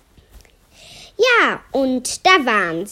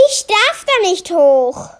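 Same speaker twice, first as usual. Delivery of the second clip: ordinary delivery, nothing marked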